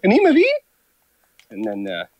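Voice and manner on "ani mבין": "Ani mבין?" squeaked very high and loud; mocking American accent. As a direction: parody a clumsy American saying "Ani mבין?". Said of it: "they just mimic my American accent".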